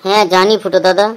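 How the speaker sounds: a follower tiptoeing around the boss's mood; timid follower. Acted. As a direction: answer the boss carefully and quietly. Other character voiced in the skit.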